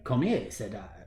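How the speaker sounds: put-on slow, soft hammy French accent. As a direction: say it like a mock French chef. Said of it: "is he French?".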